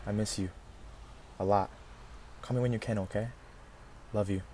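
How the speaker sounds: soft, low and subdued; tender voicemail. Acted. Calling Iris to check in from Colorado, softly tender and low. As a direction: leave a quiet message for someone you miss.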